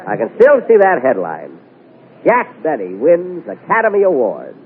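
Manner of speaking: ordinary delivery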